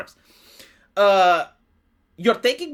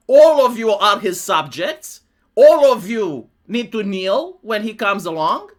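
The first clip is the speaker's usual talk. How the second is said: slow, louder mock royal proclamation; King Henry decree; 7 dB louder, slower than usual. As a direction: proclaim it like a king demanding subjects kneel.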